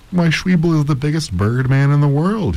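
high-pitched indignant exclamation; exclaiming. A narrator's reading. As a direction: exclaim it, amazed she doesn't know. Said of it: "he exclaimed".

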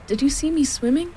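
put-on high, eager child's squeak; excited squeak. A narrator's reading. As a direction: squeak it, bursting to be noticed. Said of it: "squeaked Roo excitedly".

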